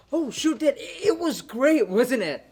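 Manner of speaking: loud, high burst of enthusiasm; excited raving. Acted. Brother raving to his sister about a movie, loud excitement. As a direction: blurt it out, thrilled, stretching the praise.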